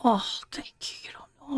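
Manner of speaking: thanking her through near tears; choked up. Real, not acted. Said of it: "You gonna make me cry".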